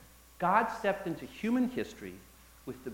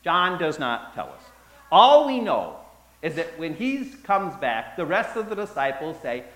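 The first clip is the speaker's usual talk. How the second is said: ordinary talk, nothing marked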